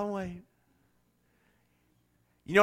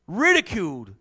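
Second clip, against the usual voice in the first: normal talking voice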